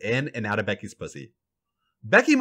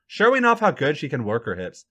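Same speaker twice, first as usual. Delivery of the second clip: usual speaking voice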